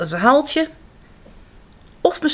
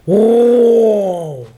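lion roar; 7 dB louder than usual. Acted.

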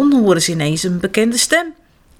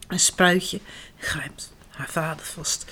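ordinary delivery

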